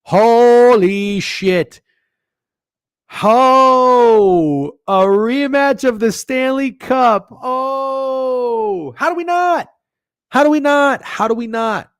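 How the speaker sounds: loud, high, drawn-out exclamations; thrilled outburst. Real, not acted. Bettor discovering a Stanley Cup rematch, thrilled. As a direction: holler in amazed delight.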